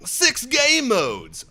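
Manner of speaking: high, very slow, drawn-out announcement; mock announcer. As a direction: stretch it out like a hype announcer. Reads feature as mock announcer.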